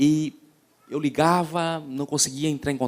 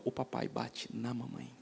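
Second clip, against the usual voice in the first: soft, much quieter, heavy repeat; quiet retelling; 2 semitones lower, 15 dB quieter than usual. Retold or imitated. Pastor repeating the child's words to the church, hushed and grave. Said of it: "a criança grita no meio de todo mundo e fala".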